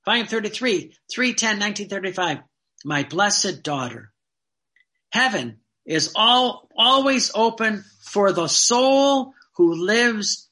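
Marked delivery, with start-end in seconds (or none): 2.9-10.5 slow devout reading. Acted. Reading Jesus' words slowly.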